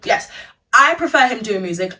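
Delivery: normal talking voice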